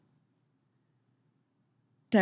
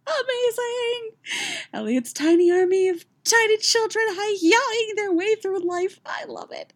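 very high, squealed enthusiasm; real gushing delight. Real, not acted. Reader gushing over Elliot's tiny army, thrilled. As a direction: squeal with fannish joy.

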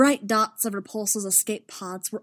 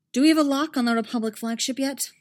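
urgent command; 6 semitones higher, 6 dB louder than usual. Acted. Admiral asks under fire.

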